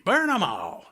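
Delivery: a hint of a sinister urge; mock-menacing encouragement; lightly voiced leprechaun urging Ralphie. Echoing leprechaun line.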